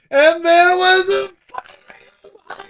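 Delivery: slow, high, drawn-out movie line; Willem Dafoe quote. Retold or imitated. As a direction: stretch each word like the actor.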